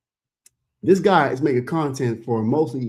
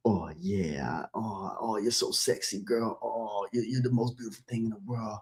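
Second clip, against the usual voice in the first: low, soft seductive croon; 5 semitones lower, 4 dB quieter than usual. Retold or imitated. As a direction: lower and softer, crooning like a lover.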